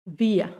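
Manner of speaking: "wir" ending in an open A sound; vocalized final R. Shown as a demonstration. Teacher demonstrating "wir" with final R as A. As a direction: let "wir" glide into A, no R. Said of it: "termina como un sonido de A".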